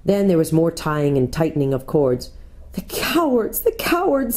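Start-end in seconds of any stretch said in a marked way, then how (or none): 2.7-4.4 weep the words out; sobbing. A narrator's reading. Susan watching Aslan bound, high sobbing. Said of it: "sobbed Susan".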